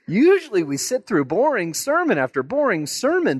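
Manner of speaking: high, excited churchgoer voice; amazed congregation. Acted. Churchgoers comparing Jesus with other rabbis, high excited gushing. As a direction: gush in a high voice as the astonished crowd. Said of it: "everybody in church, they just go".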